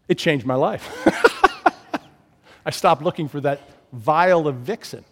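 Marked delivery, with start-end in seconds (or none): 0.9-2.0 high airy burst of laughter; breathless laugh. Real, not acted. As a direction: laugh at your own story. Laughing at the dad story.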